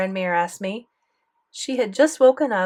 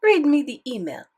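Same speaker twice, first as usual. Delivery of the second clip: put-on higher, firm French-accented order; insistent grandmother with French accent; 6 semitones higher, 4 dB louder than usual. A narrator's reading. Grandmother telling grandson to read, French accent. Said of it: "Grandmere had a thick French accent".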